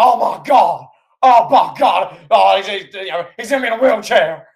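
loud, high-pitched wrestling commentator outcry; Jim Ross. Retold or imitated. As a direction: imitate an outraged announcer yelling in disbelief. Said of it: "Jim Ross is like".

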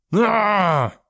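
high, drawn-out howl; long falling "aah" scream; ghostly shriek. A narrator's reading. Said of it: "shrieking and howling".

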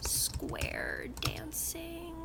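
slow sheepish whisper; slipped in with a whisper. Acted. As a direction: whisper it under your breath. Whispered item in skills list.

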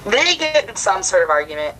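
normal talking voice

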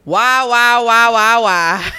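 loud repeated chant of why; shouting why. Pretend.

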